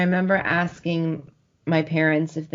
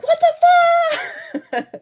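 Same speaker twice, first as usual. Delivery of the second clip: high, drawn-out squeal of disbelief; loud starstruck shriek, breaking into laughter; retold shock; 6 dB louder, much slower than usual. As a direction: re-enact being stunned, stretched out and way up high. Retold shock as band appeared.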